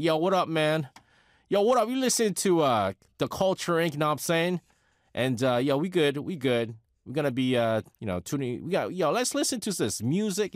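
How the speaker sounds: imitating a New York gangster kid DJ yelling "yo" on air. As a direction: play a brash young New York gangster kid hyping the radio. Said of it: "young gangster kid from New York".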